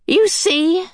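high, delighted cry; happy medium crying out. A narrator's reading. The Medium cheering the children, high and happy. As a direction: beam as you cry it out. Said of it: "the medium cried, smiling happily".

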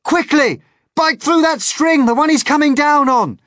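high, loud shouted order; urgent yelling. Acted. James orders centipede to bite the string, yelling. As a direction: yell the order with desperate urgency. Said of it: "yelled James".

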